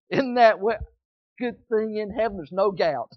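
ordinary delivery

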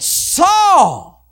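high, loud drawn-out call; booming summons, stretched long; great voice from heaven. Acted. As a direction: cry the name out from on high. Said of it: "a great voice came out of heaven".

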